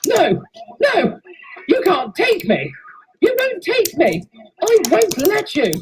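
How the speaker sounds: high, loud desperate screams; villain screaming as the ghosts drag her away; screaming refusal. Acted. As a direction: scream it out as they close in. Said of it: "who started screaming".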